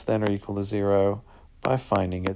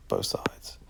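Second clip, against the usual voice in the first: quick whispered self-correction; under-the-breath fix; whispered aside; 8 dB quieter than usual. Real, not acted.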